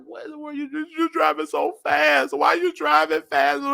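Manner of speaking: high, startled cry at the speed; half-crying alarm to the driver. Retold or imitated. To Theo driving fast, high alarmed cry. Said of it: "So I'm like".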